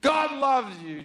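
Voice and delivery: high, slow mock yell; parting yell. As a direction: call it out while being dragged away. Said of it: "she'll yell something out like".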